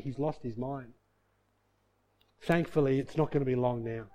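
low, soft aside of relatives; acted head-shaking resignation, voice dropping; resigned. Family members shaking their heads over him, quietly resigned.

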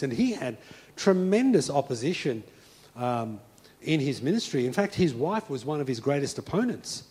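ordinary delivery

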